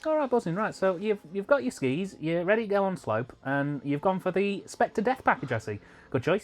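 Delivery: put-on high, cheery Manchester accent; breezy northern Manchester lad. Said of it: "Is that your Manchester voice".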